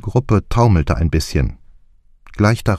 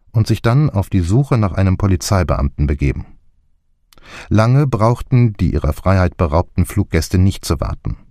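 ordinary delivery

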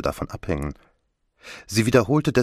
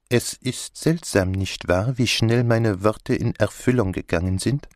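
put-on lightly accented detective voice, measured opening; Poirot; 3 semitones higher than usual. Poirot greets Catherine about his words coming true, musing character voice. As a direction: muse politely as the detective.

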